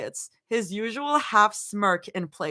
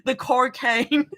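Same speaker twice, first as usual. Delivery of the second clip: slow, amused Australian attempt; Australian accent; 2 semitones higher, slower than usual. Shown as a demonstration. Streamer cracking up at own accent, drawn-out Australian. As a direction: stretch it out in a mock Australian accent. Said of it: "Guys, Australian is fucking hard".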